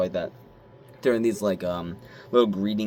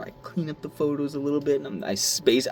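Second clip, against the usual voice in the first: ordinary delivery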